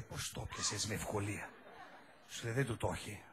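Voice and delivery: a hint of the man below answering; lightly voiced man. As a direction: drop into a low murmur for the man.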